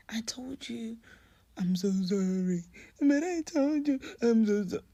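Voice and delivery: high, loud, tearful-sounding mom at full pitch; upset mother yelling. Retold or imitated. Mother fighting with the father in front of her daughter, loud and high. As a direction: throw it at him, half apology half accusation.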